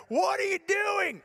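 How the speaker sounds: high, drawn-out incredulous demand; mock wifely outcry, stretched and high; imitating alarmed wife. Wife at the door to husband by the fire, high alarmed mock outcry.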